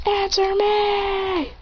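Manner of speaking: high drawn-out yell at no added volume; mock yell. A narrator's reading. Teacher calling on an inattentive student in class, mock yell. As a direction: stretch it out like a high holler. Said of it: "He yells and says".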